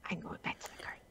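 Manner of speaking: very soft breathy whisper; faint whisper. Acted. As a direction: barely whisper it, stubborn.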